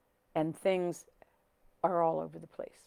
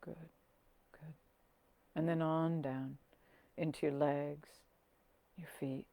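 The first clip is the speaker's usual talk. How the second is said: soft, unhurried meditation voice with long pauses; hushed, settling calm; guided meditation; 5 dB quieter than usual. Acted. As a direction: guide them gently down into the body.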